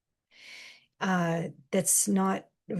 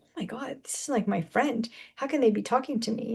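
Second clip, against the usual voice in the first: shocked disbelief; 4 semitones higher, faster than usual. Retold or imitated. Hurt listener reacting to a friend's insult, lightly shocked. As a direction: act stunned that a friend would say it. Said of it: "And you're like".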